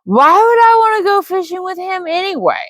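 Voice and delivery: put-on high, scornful drunken spit; spat. A narrator's reading. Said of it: "he spat".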